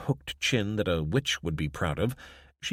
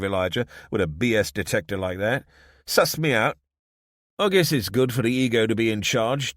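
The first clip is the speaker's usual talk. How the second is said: lightly voiced wry Desmond; 5 semitones higher, 5 dB louder than usual. Desmond wryly teases Elijah.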